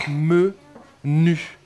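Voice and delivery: "me", pause, "nu", each held; syllable by syllable. Shown as a demonstration. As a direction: split it into "me" and "nu" for dictation. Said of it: "La première syllabe".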